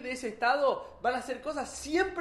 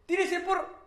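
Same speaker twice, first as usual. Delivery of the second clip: high, loud command cut short; sharp order; 9 semitones higher, 5 dB louder, slower than usual. Acted. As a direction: snap out the order before he jumps. (el zar da la orden, alto y cortante)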